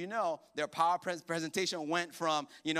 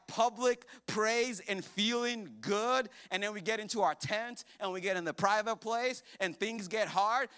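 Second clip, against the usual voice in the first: ordinary delivery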